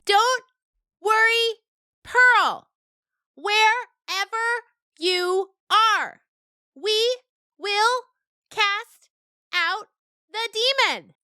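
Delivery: put-on a kid goat shouting an exorcism promise, slowly; slow loud bleat. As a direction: enunciate each word slowly into an ear. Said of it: "bleated directly into the dog's ear at maximum volume, enunciating each word slowly and clearly".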